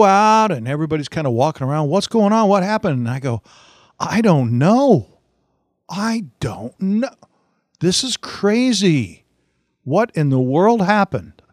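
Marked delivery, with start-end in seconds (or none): 1.9-3.0 higher, louder urgent questions; retold street clamour, high and loud; alarmed neighbours asking around. 4.0-11.4 high, slow, drawn-out mock astonishment; hammed-up innocent disbelief, stretched out high; feigned bewilderment. Feigns ignorance to the neighbours.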